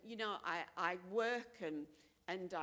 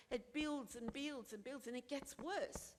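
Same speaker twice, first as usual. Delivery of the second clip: ordinary delivery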